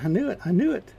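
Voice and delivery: gleeful told-you-so; excited. Real, not acted. Finds eggs in the cave.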